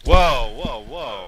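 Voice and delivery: startled, loud protest; surprised whoa. Real, not acted. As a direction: jump in, alarmed. Reacting to raffle joke.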